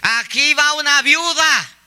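high, slow, drawn-out announcement; dress announcing. Acted. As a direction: proclaim it like a street crier. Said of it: "el vestido, ¿qué dice?". (pregón agudo y alargado)

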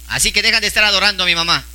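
rushed, fast scolding line; quick rebuke. Acted. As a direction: fire it off quickly as Jesus' rebuke. (reprimenda rápida)

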